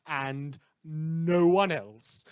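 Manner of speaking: drawn out, bone-dry punchline; slow deadpan. Acted. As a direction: let it hang slowly for the joke. Sarcastic punchline about Watchdog members.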